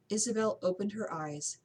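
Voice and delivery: plain reading voice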